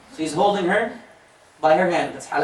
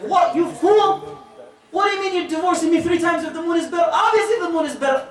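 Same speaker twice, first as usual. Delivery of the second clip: indignant bride shout; 5 semitones higher than usual. Retold or imitated. Bride shouts at her husband on their wedding night, outraged. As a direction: blast him with disbelief.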